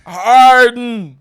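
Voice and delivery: loud, high, drawn-out "Harden"; insistent shout. Real, not acted. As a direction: stretch the word out at full volume. Insisting in pronunciation argument.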